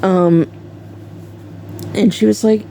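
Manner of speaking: usual speaking voice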